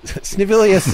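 laughing. Real, not acted. Narrator laughs at silly name.

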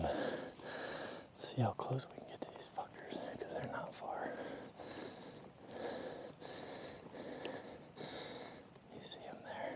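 very soft whisper to the camera; real hushed excitement, barely audible; stalking whisper. Real, not acted. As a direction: whisper so the nearby animals don't hear. Whispering while creeping up on elk.

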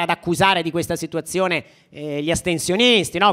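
ordinary talk

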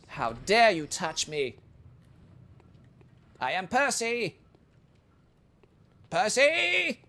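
outraged noble shrieking his own name; pompous lord losing his temper; angry Percy. Acted. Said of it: "That's what he sounds like when he gets angry".

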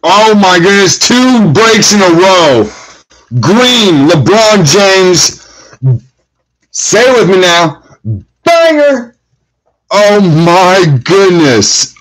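high, drawn-out marvelling; thrilled. Real, not acted. To viewers after two breaks in a row, high thrilled marvel. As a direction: savor the LeBron pull, slow and high. Second big hit in row.

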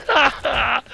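high delighted laugh; laughing at the blast; gleeful laugh. Real, not acted. Gamer watching enemies explode, high gleeful laugh.

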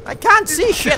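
loud, high exasperated yell; frustrated yell. Real, not acted. Complaining about lag in game.

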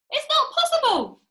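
very high, loud wail of disbelief; distressed cry. A narrator's reading. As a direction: cry out the denial in exaggerated alarm. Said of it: "cried Missus Twit".

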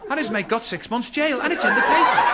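high and loud, laughing through words; can barely get it out for laughing; laughing helplessly. Real, not acted. Helpless laughter after punchline.

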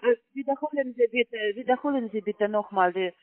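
normal talking voice

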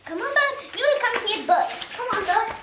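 high, loud calls to the bird; excited calling. Real, not acted. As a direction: urge the bird to come over.

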